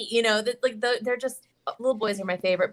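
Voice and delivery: ordinary talk, nothing marked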